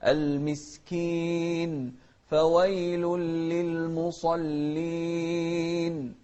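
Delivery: slow, sung recitation; slow sung Quran recitation; melodic Quran recitation. Shown as a demonstration. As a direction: chant it slowly and melodically.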